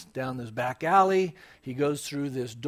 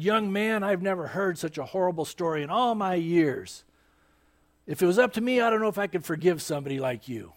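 raised, higher-pitched scolding; stern rebuke; 4 semitones higher, 4 dB louder than usual. Retold or imitated. Finney grabs the confessing man, stern rebuke. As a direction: scold him as if shaking him by the collar.

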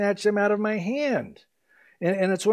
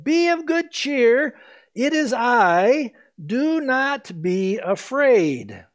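calling out; 6 semitones higher, 3 dB louder, much slower than usual. Acted. Jesus calls out on the water.

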